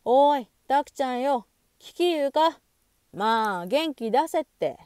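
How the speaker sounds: high, loud boisterous phone call; loud hollering. A narrator's reading. Asashio yelling down the phone to cheer up Taku, boisterous hollering. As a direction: holler heartily to buck up a friend. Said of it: "朝潮が大声でわめいた".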